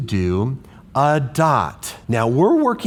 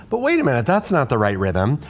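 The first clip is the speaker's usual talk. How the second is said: ordinary talk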